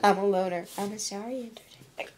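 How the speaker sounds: low, sulky impression of a loner; mopey impression. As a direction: droop it like a sad sack. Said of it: "That's my impression".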